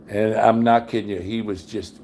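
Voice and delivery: ordinary delivery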